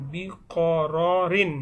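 বিকররিন slowly, with every syllable stretched; careful difficult pronunciation. Shown as a demonstration. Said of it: "জটিল উচ্চারণ আবার বলছি". (বিকররিন ধীরে ধীরে, স্পষ্ট করে টেনে উচ্চারণ)

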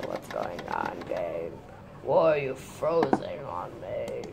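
very low, far-off put-on voice; deep odd voice. Real, not acted. Complaining to the frozen game, very low odd voice. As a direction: drop into a strange deep voice. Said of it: "Where the hell did I get that voice from?".

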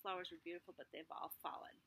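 whispered, hushed and sad. A narrator's reading. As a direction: breathe it out softly. Sad end of the tree.

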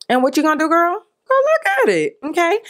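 put-on high-pitched sassy girlfriend prodding; squeaky, teasing nudge; sassy urging. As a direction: pitch it way up, playfully pushy. Sassy aside urging viewers to watch.

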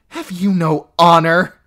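an aunt scolding her niece; sharp. Acted. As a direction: snap the question. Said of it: "her aunt said sharply".

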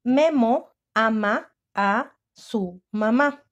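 very slow and drawn out; slow repeated dictation. Shown as a demonstration. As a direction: stretch each word so children can write.

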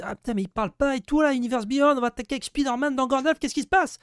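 very high-pitched mock voice of impatient fans; put-on falsetto of fretting viewers; complaining viewers. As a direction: squeal it like a panicking fan, mocking. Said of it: "mais pour tous ceux qui se disent".

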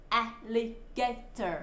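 "Alligator" slowly split into four syllables. Shown as a demonstration. Teacher spelling out for children, "Alligator" broken into slow syllables. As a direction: sound out "Alligator" one syllable at a time. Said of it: "các con đánh vần được".

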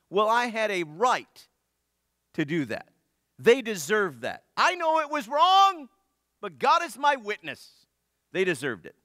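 slow, loud, high whiny excuses; self-justifying sinner. Retold or imitated. Voicing a sinner justifying himself.

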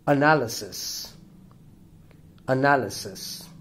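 "analysis" with a strong NAL; second-syllable stress. Shown as a demonstration. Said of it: "The stress falls on".

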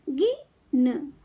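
गिन slowly, with a gap before न; sound by sound. Shown as a demonstration. Teacher teaching kids to read, sounds apart. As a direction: break गिन into गि - न. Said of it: "शब्द पढ़ना सिखाऊंगी".

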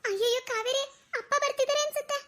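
put-on high, panicky girl's warning; alarmed. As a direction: warn a friend in high-pitched alarm. Girl alarmed that father is coming.

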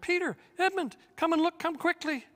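put-on high, loud excited call to the boys; cried out. A narrator's reading. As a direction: call them over, thrilled and urgent. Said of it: "she at once cried out".

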